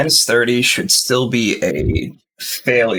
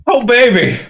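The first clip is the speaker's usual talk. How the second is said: excited shout; 12 semitones higher, 5 dB louder than usual. Real, not acted. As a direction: blurt it out with relief. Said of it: "I'm panting".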